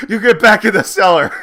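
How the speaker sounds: high, loud hammed-up scolding; mock angry order. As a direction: shoo them off in comic outrage. Acted shooing of unwanted character.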